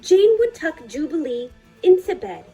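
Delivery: ordinary delivery, nothing marked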